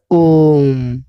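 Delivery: ओम held out three times as long; ओम as a pluta vowel, drawn out; prolonged vowel. Shown as a demonstration. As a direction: stretch the vowel of ओम slowly. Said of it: "ओम को बोलने में तीन गुना समय लगे".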